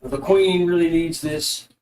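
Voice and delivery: urgent elf rallying his men, pitch lifted at first; adamant elf. Acted. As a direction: press the point firmly as the elf. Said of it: "I guess I'll do the elf guy".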